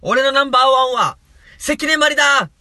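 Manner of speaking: high, loud declared shout. Acted. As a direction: yell it out to the whole room. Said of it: "大きな声で、…叫びてください".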